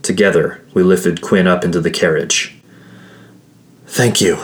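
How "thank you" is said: ordinary delivery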